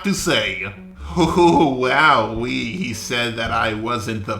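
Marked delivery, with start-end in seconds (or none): none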